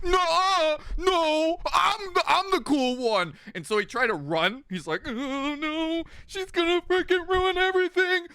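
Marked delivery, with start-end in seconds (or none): 0.0-3.3 high, loud squeaky Adam brushing off Lilith; a hammed-up high whine, full of himself; mock haughty Adam. Streamer voicing Adam refusing Lilith's equality, high and loud. 5.1-8.3 high squeaky panic, a bit louder; a hammed-up cartoon fret; mock panicked Adam. Streamer voicing Adam fearing Lilith, high and squeaky.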